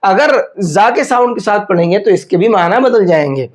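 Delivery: normal talking voice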